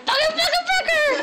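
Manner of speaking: put-on high New York accent. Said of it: "Here comes a New York accent".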